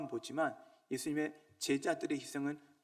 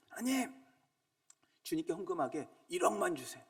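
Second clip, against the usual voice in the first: higher, wheedling petition; begging God for a hundred million won; mock pleading prayer; 4 semitones higher than usual. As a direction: play a greedy believer begging God. Mock prayer of a greedy man.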